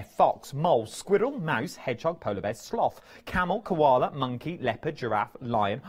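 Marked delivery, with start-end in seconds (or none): none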